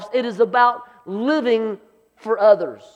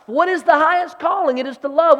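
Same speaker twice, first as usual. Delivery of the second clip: preaching; 5 semitones higher than usual. Acted. Preacher building to climax.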